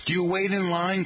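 IN lifted high, questioning; stress on IN. Shown as a demonstration. Host quizzing listeners on their regional wording, stress on IN. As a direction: punch "in" with a high pitch. Contrasts with New Yorkers' phrase.

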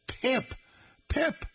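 put-on "Pip" squeaked very high, like a toddler; squeaky Pip. Shown as a demonstration. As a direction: chirp "Pip" high like a little kid. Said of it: "it came out for some reason as Pip".

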